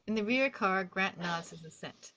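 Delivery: normal talking voice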